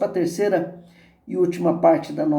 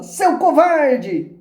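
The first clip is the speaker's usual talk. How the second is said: high, drawn-out echoing insult; slow, high answering holler; echoed shout; 9 semitones higher, 4 dB louder, much slower than usual. A narrator's reading. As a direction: throw it back like an echo. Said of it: "Ele escuta como resposta".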